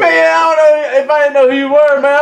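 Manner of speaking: laughing. Real, not acted. Friend laughing while teasing.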